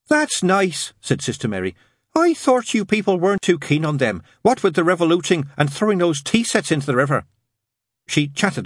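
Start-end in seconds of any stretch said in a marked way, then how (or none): 0.1-0.9 high, eager nun voice; chatty nun. A narrator's reading. Nun chats politely. 2.1-7.2 high, eager nun voice; chatty nun. A narrator's reading.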